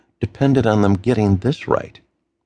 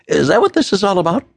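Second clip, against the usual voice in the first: anxious man's voice; 10 semitones higher, faster than usual. Acted. Matt's voice, anxious question.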